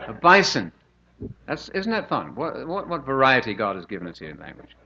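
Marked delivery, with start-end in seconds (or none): 0.1-0.7 New Zealand bison. Shown as a demonstration. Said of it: "And in New Zealand".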